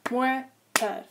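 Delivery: "Motor" split into two separate syllables; "Motor" in two distinct beats; broken into syllables. Shown as a demonstration. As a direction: say "Motor" one syllable at a time. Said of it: "check the number of syllables".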